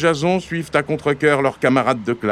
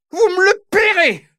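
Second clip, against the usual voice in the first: much higher, shrill angry sister; furious yell; 4 dB louder than usual. Acted. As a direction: scream the blame at them. Sister threatens the boys. (Mylène hurle, voix suraiguë et furieuse)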